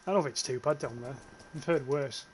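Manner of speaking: ordinary talk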